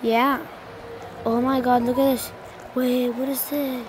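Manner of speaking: normal talking voice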